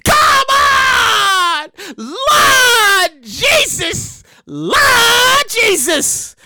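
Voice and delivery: high, long-drawn mock wail; pleading, stretched-out holler; exasperated wail. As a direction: cry out to heaven, dragging out the vowels. Exasperated wailing at the story.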